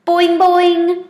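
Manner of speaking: high, loud springy frog call; frog boing. A narrator's reading. Frog calling out to the class, high and loud boing. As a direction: twang it out like a bouncy frog. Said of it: "Og said".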